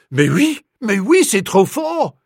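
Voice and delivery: loud French outburst, rising in pitch; shouting. A narrator's reading. Hotel manager backing up the accusation, shouting. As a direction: shout your indignant support in French. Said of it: "shouted the bandit chief".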